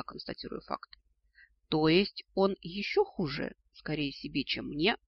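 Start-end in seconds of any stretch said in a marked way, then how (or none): none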